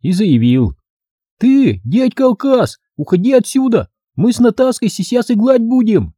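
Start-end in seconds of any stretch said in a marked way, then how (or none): 1.4-6.1 put-on squeaky little kid bossing a grown-up; six-year-old boy. Little boy ordering a man out of the bushes, high childish lisp. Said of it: "чумазый шестилетний карапуз".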